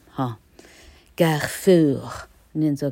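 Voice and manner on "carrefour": CARREFOUR said as French kar-FOOR; French pronunciation. Shown as a demonstration. Teacher contrasts it with the English reading, French pronunciation. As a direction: give CARREFOUR its French sound. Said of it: "法文的话念作".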